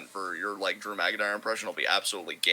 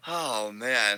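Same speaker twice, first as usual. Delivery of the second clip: ordinary delivery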